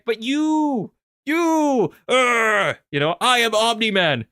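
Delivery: high, exasperated pleading to Ryan; frustrated outburst. Retold or imitated. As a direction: vent your frustration at the one who forced this. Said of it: "I'm like".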